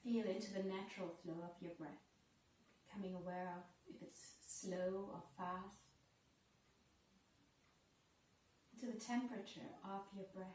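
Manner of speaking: soft, unhurried yoga teacher voice, with long pauses; guided breathing meditation. Real, not acted.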